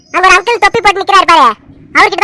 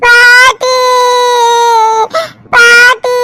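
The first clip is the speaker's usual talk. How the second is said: higher, long-drawn tearful wail; crying child; 4 semitones higher, much slower than usual. Acted. Child calling grandmother for help, crying. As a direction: cry out for granny, stretching the last vowel. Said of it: "நீ ஏண்டி அழுவுற".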